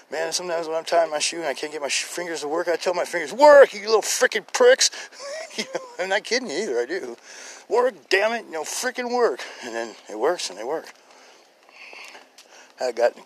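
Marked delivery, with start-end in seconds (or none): 3.3-4.9 loud, high, slow scolding yell; mock-angry command. Scolding his own stubborn fingers. 7.7-9.4 high-pitched frustrated command; mock exasperation. Repeats order to his fingers.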